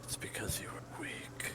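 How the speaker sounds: whispered, ghostly echo of accusation. Acted.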